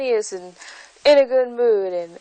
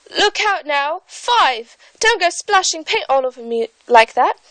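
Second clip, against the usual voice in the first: a high, lightly voiced gardener warning, British accent; gardener calling out; 7 semitones higher, 4 dB louder than usual. Gardener warns Five about paint, mildly alarmed British accent. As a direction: warn him off, a touch of panic, British accent. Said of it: "she heard one of them say".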